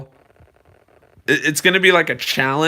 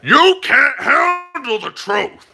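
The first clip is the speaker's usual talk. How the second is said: high, drawn-out mock outburst; hammed-up film quote, stretched out; dramatic movie retort; 10 semitones higher, 3 dB louder, slower than usual. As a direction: fire back slowly in a silly high voice. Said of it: "And then he said".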